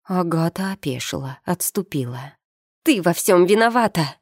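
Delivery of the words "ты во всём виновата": higher-pitched accusing cry; restrained squeal of blame; mild shriek. A narrator's reading. Daughter blaming her mother for everything, mildly shrieking. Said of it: "взвизгнула Элька".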